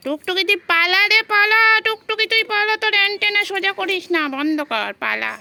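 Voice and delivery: loud high shout at the cat to run; yelling warning. Real, not acted. Owner shouting at her cat Tuktuki to flee. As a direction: holler urgently so she flees.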